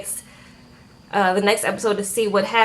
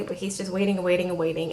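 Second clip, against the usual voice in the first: ordinary talk, nothing marked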